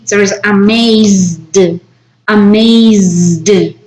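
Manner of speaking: "amazed" with a buzzing Z and a voiced D, said slowly as "amaizd". Shown as a demonstration. Teacher showing the Z-D ending to the class, slowly. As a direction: stretch the Z in "amazed". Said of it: "that is like Z".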